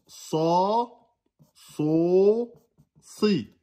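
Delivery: sa, so, si with heavy, dark vowels; emphatic vowels. Shown as a demonstration. Teacher drilling the letter sad, emphatic vowels. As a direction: say "sa, so, si" with a full emphatic s. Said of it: "avec les trois voyelles emphatiques".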